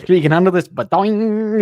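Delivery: loud, high cartoon boast ending in a springy boing. Showing off to Elmer Fudd, loud high cartoon boing.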